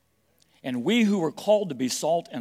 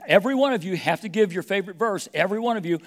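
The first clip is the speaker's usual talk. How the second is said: high and loud, bright classroom call; excited teacher voice; 7 semitones higher, 8 dB louder, faster than usual. Retold or imitated. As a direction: call the class like an eager Sunday school teacher. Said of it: "Sunday school teacher might walk in and says".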